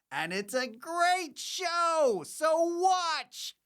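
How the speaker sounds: high, drawn-out singsong sell; hyped-up plug. Real, not acted. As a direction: push the show, stretching the words out high. Host hypes up the show intro.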